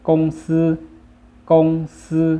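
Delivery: กงซือ as Chinese, high, level and loud; Chinese pronunciation. Shown as a demonstration. Teacher models the word for company, Chinese pronunciation of กงซือ. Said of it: "ภาษาจีนอ่านว่า".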